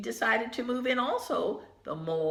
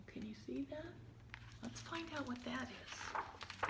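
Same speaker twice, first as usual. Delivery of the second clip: whisper; 2 semitones higher, 17 dB quieter than usual. Real, not acted.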